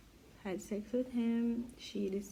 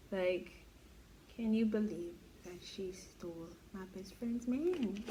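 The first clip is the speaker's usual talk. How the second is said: usual speaking voice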